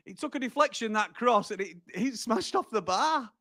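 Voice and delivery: high, soft, aghast voice; stunned disbelief. Real, not acted. Fan describing the deflected cross, stunned disbelief. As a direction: recount the near miss in a high, quiet voice. Stunned after near miss.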